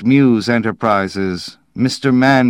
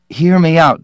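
higher, louder urgent appeal; desperate plea; 9 semitones higher, 7 dB louder than usual. A narrator's reading. Slade begging Dowland to listen, desperate plea. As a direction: plead urgently to be heard. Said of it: "feeling desperate".

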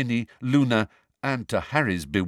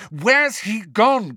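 louder, higher and slow; pressing repeat; 12 semitones higher, 6 dB louder, much slower than usual. A narrator's reading. Harry repeats the question more forcefully, slow.

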